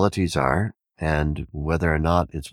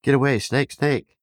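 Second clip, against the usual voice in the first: panicked shouting; 9 semitones higher, 3 dB louder than usual. Retold or imitated. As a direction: get away called out much higher and louder. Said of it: "they start shouting".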